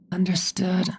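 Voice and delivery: short breathy whisper. Acted. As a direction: whisper a curt reply. Soren answers curtly.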